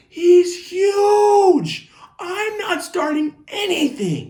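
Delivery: very high, loud shouted protest; acted kid's screechy protest, drawn out at the end. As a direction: yell back in outraged self-defense. Kid protesting to screaming teacher.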